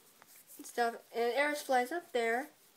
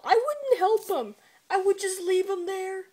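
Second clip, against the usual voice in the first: higher and louder, a worked-up rant; excited outburst; 9 semitones higher, 7 dB louder than usual. Real, not acted. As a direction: blurt it out, all fired up. Teller's own outburst about Laval.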